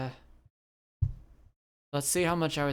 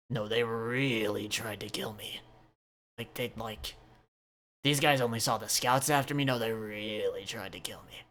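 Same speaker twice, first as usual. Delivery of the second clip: lower, hushed insistence; Riddle, grave; 4 semitones lower, 5 dB quieter than usual. Acted. Riddle telling Mary of the attack, low grave voice. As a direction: press the danger in a lower hush. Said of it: "he's like".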